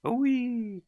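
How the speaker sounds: very high, loud pained squeal; crocodile's yelp. A narrator's reading. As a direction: squeal as you're knocked flying. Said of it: "cried the crocodile".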